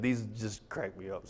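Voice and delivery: ordinary talk, nothing marked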